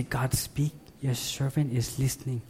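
soft, hushed prayer; quiet prayer. Shown as a demonstration. Preacher showing how to sit still before God, quiet prayer. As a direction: drop the volume and pray simply.

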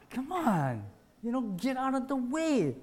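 impatient. Acted. Acted impatient shopper blocked in aisle.

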